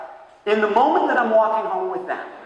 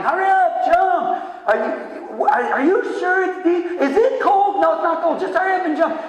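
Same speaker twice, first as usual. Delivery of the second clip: put-on high-pitched squabbling kid voices, much higher; bickering kids; 11 semitones higher than usual. Boy in the water urging the girls on, high kid voices. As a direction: play both the boy and the nervous girls, pitched way up.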